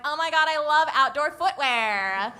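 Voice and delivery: put-on Valley Girl accent. Imagining the sender reacting to shoe photo, in Valley Girl accent. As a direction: gush like an excited Valley Girl. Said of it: "went with a Valley Girl accent".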